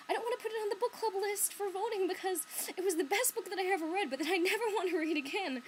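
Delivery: high, loud mock protest; imitating librarian. Librarian refusing to list a book for voting, high loud protest. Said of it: "my librarian was like".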